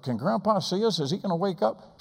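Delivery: put-on high, innocent child asking; little boy's voice. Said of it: "I tugged my mom's skirt".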